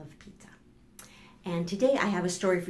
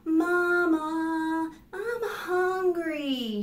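put-on high, drawn-out child's whine; hungry little girl; 10 semitones higher, much slower than usual. Vivian tells Mama she is hungry, high drawn-out whine. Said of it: "she said".

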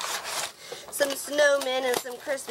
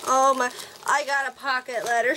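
usual speaking voice

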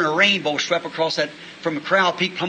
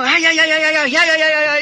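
loud, high squirrel chatter; scolding squirrel; 10 semitones higher, 6 dB louder than usual. Retold or imitated. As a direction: yap like an angry little squirrel. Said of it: "He's carrying on like that".

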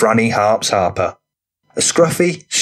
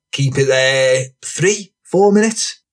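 rough lad voice; 4 semitones higher, slower than usual. A narrator's reading. Franny character voice.

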